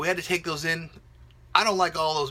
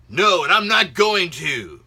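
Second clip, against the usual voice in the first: stubborn refusal; 3 semitones higher, 8 dB louder, slower than usual. Retold or imitated. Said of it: "And then you're like".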